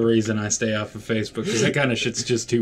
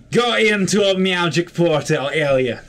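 raised, louder mock-Russian declaration; bad Russian accent; 5 semitones higher, 4 dB louder than usual. Player sending the character into a magic portal, bad Russian accent. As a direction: put on a hammy Russian accent. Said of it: "Bad Russian accent".

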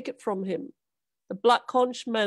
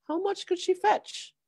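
greedy wonder; 10 semitones higher than usual. A narrator's reading.

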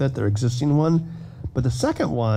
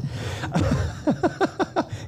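laugh; 4 dB quieter than usual. Real, not acted. Laughing at own Milwaukee joke.

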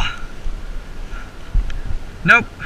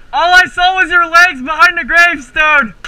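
shout; 7 semitones higher than usual. Real, not acted. Shouting apology to ref.

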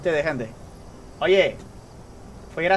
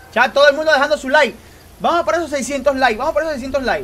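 higher, louder hype call; hyping viewers; 6 semitones higher, 5 dB louder than usual. Real, not acted. Streamer rallying viewers for likes, hype call. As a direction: pump up the chat.

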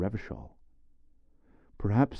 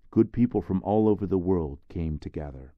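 ordinary delivery

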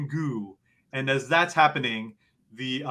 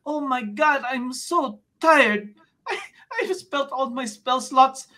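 put-on much higher, tired old lady voice; exhausted grandma; 13 semitones higher than usual. Lola Carly lying on the ground after days of healing, high and weary. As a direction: sigh it out like a worn-out grandmother. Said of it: "lying on the ground going".

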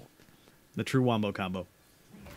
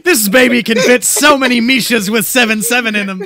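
Brooklyn car salesman pitch; Brooklyn accent; 4 dB louder than usual. Acted. Selling a deck like a car, brash Brooklyn accent. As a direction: sell it in a punchy Brooklyn accent. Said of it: "slowly turned into a Brooklyn accent".

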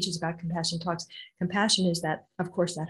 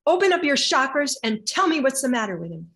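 loud, raised-pitch retold yell; 4 semitones higher, 7 dB louder than usual. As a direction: yell the order like a fierce teacher. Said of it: "And she yelled, literally".

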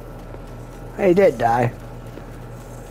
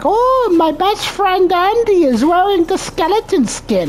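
very high, squeaky mimicry of a fan; high mocking fangirl voice; mocking copycat voice; 3 dB louder than usual. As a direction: mimic a gushing kid, much higher. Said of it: "They're like".

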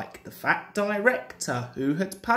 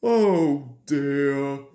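low, drawn-out engine lament; Gordon dismayed; 4 semitones lower than usual. A narrator's reading. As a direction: sigh it out low and sorry for yourself. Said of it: "said Gordon".

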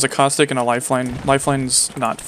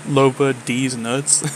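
each word spelled out slowly; slow, clear repeat; much slower than usual. Real, not acted. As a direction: say it again slowly for someone who missed it. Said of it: "Loba what?".

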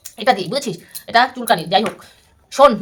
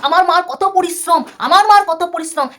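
a touch of whiny mimicry, higher; mocking his words; 4 semitones higher than usual. Vlogger mimicking rival's claim about his mother, mocking. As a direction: parrot the rival's excuse with scorn. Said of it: "তুই আবার বলছিস".

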